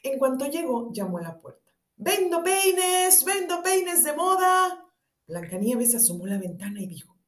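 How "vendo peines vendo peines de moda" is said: high, very slow hawker's call; old peddler voice. A narrator's reading. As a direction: drag out the seller's cry. Disguised queen peddling combs.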